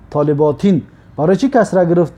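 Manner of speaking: ordinary talk, nothing marked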